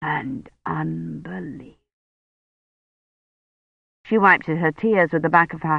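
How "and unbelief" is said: slow, much softer, thick-tongued words; blurred dying voice. A narrator's reading. Dying Roche speaks to Kivrin, slow, soft and blurred. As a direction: speak through a swollen tongue.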